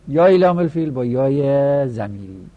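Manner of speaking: usual speaking voice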